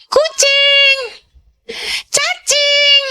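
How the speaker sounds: sing-song high teasing of "Kucing" and "Cacing"; mocking playground taunt. Classmates teasing [name] about her name, high mocking taunt. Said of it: "mereka ngeledekin saya apa?".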